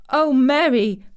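loud, overwhelmed cry; half-sobbing cry. A narrator's reading. Weak boy overwhelmed by his friend's news, half-sobbing. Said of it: "cried out with a half sob".

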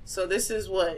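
usual speaking voice

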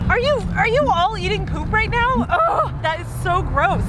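high-pitched, appalled outcry; grossed-out squeal at the dogs; disgusted disbelief. Acted. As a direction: react appalled, pitch shooting up. Catches dogs eating poop.

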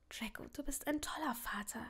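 soft, breathy, tender praise; hushed, sincere encouragement; tender reassurance. Acted. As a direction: say it gently, nearly whispering. Woman tenderly reassures tired father.

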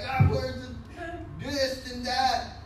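a flustered, panicked search; mock frantic outcry while losing my mind; frantic flustered cry. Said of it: "And I'm losing my mind".

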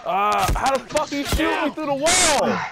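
high, loud calls for a teammate; panicked shouting. Real, not acted. Shot through wall, calling teammate.

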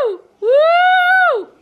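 loud high party whoop; excited whoop. Real, not acted. A mom whooping as the party starts, loud and high. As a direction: cheer it out at the top of your lungs.

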